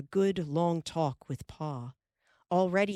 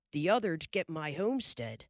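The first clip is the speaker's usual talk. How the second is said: ordinary delivery, nothing marked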